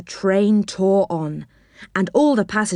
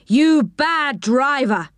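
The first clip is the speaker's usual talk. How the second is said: higher, drawn-out angry scolding; scolding shout; 6 semitones higher, slower than usual. A narrator's reading. Little man rushes out at Joe, shouting crossly. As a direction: tell him off with mild storybook outrage. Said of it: "he shouted".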